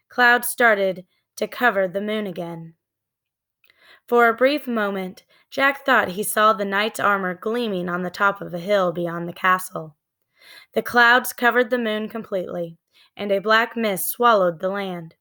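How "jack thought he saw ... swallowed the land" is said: ordinary delivery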